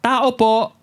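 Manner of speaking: mild call into a house. Shown as a demonstration. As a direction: announce yourself at someone's door. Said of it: "You're supposed to yell out".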